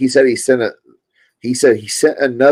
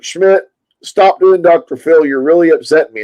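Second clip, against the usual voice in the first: mad complaint, imitated; 6 semitones higher, 4 dB louder than usual. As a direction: mimic an upset friend telling you off. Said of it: "He gets so mad".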